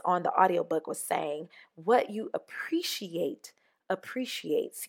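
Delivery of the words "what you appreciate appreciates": softly whispered, slow quote. Retold or imitated. Quoting a guest's wisdom from Oprah's audiobook, whispered. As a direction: whisper it like a secret truth.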